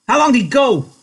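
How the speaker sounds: loud, high aggressive question; loud, high, ready for a fight. A narrator's reading. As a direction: push the question like a man ready to brawl. Curly spoiling for a fight.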